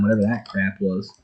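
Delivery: usual speaking voice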